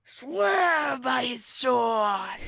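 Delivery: slow, very loud, drawn-out ghostly call; a ghost's long echoing order from the underworld; ghost's booming command. Acted. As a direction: boom it up from beneath the stage like a ghost.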